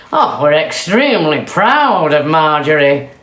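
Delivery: loud, booming announcement; proud farmer. Acted. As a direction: proudly address the crowd. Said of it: "announced the farmer to the crowd".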